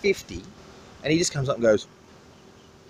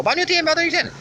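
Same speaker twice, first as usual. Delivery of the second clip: much higher, eager mock haggling; locals haggling in Vietnamese; faster than usual. Locals at the beach asking the price of fish, high eager calls. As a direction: call out prices like an eager market buyer. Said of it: "and they just go".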